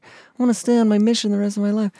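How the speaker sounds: hammed-up begging to stay; mock whiny plea. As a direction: whine it like a missionary who won't leave. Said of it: "You shouldn't be like".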